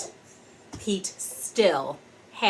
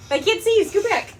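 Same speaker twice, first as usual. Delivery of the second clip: calling out; 11 semitones higher, 5 dB louder, faster than usual. Real, not acted. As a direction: call her back into frame. Calling to child.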